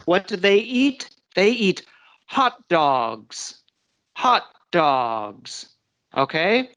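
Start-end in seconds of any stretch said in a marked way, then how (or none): 2.3-3.6 HOT dogs, HOT stressed and falling. Shown as a demonstration. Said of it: "Did everyone hear that?". 4.2-5.7 HOT dogs again, HOT stressed. Shown as a demonstration. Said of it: "Did everyone hear that?".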